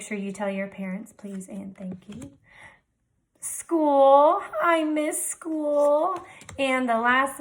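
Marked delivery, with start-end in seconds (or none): 1.1-2.3 whisper. Acted. Teacher models polite words. 3.4-6.2 wistful. Real, not acted. Teacher misses school in quarantine.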